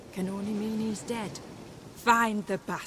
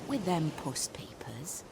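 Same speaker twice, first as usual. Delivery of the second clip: sly whisper; 5 semitones lower, 7 dB quieter than usual. Acted.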